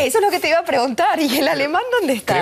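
high, quick and eager; eager, rushed cut-in; excited interjection. Real, not acted. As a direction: jump in with excitement.

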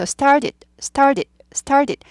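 "started" with the middle t softened to a d; soft flapped t. Shown as a demonstration. Teacher models "started" for learners, flapped t. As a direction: say "started" staccato, the t not popping. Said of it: "t가 튀지 않죠".

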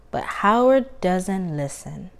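plain reading voice

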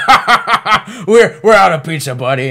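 loud, high cackling laugh, then a sneer; mocking demon laugh. Demon at the fast-food stand taunting the soul, loud gleeful cackle. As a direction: cackle right in his face, then land buddy. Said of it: "the demon there is like".

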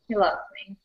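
soft unvoiced aside; whisper. Real, not acted. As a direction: whisper it like a secret. Bragging aside about tattoo artist.

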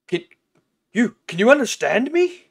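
high eager question; excited. Acted. Sheep asking eagerly.

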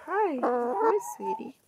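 high sing-song coo leaping up on sweetie. Real, not acted. Woman greeting her hen sweetly during the cooking talk.

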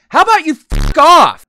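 high, loud mock fury; acted angry retort. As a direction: fire it back as the injured party. Acts an angry retort to driver.

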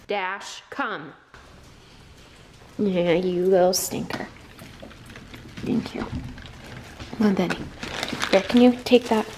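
short firm dog commands; firm sharp words to the dog. Real, not acted. Owner calls her dogs with firm commands.

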